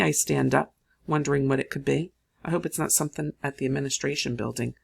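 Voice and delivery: usual speaking voice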